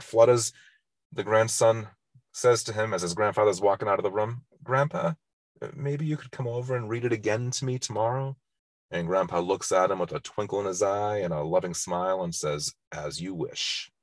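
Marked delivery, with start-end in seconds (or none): 4.6-8.4 put-on higher, hushed child's voice, pleading; little grandson. Grandson asks grandpa to read again. 12.9-13.9 much softer and lower, loving; tender grandpa. Retold or imitated.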